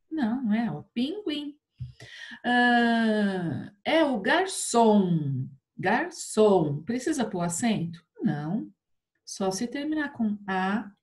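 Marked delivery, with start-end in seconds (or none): 3.8-6.8 "garçom" slowed, with a long final ÇOM; stress on ÇOM. Shown as a demonstration. Teacher asking if garçom needs accent, stressed on ÇOM. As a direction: split "Garçom" and lengthen the last syllable. Teacher sounds out word syllables.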